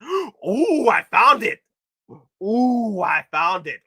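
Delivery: high, loud excited gasp; excited discovery. Real, not acted. Streamer finding the photos he searched for, thrilled. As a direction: gasp and announce it with delight.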